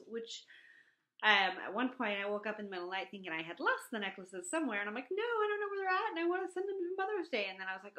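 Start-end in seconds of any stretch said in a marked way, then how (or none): 5.1-7.5 high, loud frantic wail; mock panic. Woman fearing necklaces are lost, mock panic. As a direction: re-enact a panicked whine, pitched way up.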